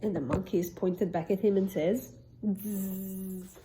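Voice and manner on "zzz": teasing buzzy monkey reply; mock them with a buzz. Monkeys buzzing back at the peddler.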